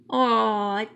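ordinary delivery, nothing marked

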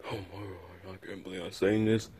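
usual speaking voice

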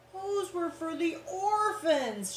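high, slow shriek; high-pitched protest, slow. Acted. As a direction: shriek it like an outraged aunt. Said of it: "shrieked Aunt Figgy".

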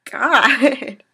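blurting out at the grisly death; horrified exclamation. Real, not acted. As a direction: cry out in disgust. Reacting to gory death.

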